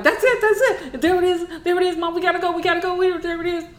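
very high excited alarm; panicked children pointing out the bug; imitating frantic kids.

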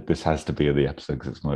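usual speaking voice

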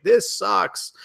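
high, scornful mock complaint; dismissive. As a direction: toss it off like a bored fan. Imagined fans dismissing the game.

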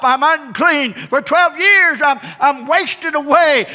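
high, loud anguished shout; desperate cry. Acted. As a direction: cry out the long suffering.

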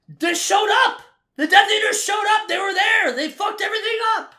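shouting it out, furious and high; exasperated fan yelling about plot holes; angry shouting. Real, not acted. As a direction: yell it at the screen in real frustration.

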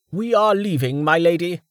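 hesitant servant, pitched high. Acted. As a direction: hesitantly urge your lady to leave. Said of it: "Emerton said hesitantly".

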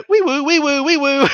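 high sing-song alarm wail; mock siren. Joking about a coworker's red flag, mock siren. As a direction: imitate a warning siren, laughing.

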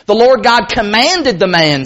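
ordinary talk, nothing marked

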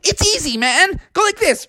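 high, easygoing cartoon dude; Sonic. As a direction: reassure casually in a high voice. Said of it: "And Sonic was like".

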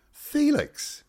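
very high, put-on echo of a name; mock squeal. Presenter echoing the quoted boy's name in a mock high squeal.

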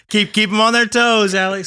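bursting out loud and high through a laugh; delighted laughing shout-out; laughing cheer. Real, not acted. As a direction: cheer him on mid-laugh.

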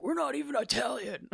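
high-pitched imitated indignation; hammed-up high protest; mock protest. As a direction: imitate an exasperated dad objecting. Dad's acted protest at nickname.

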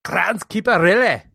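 high, hammed-up Scottish accent; comic Scottish janitor impression; Groundskeeper Willie. Shown as a demonstration. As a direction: put on a cartoon Scots brogue. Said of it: "give me your best groundskeeper, Willie".

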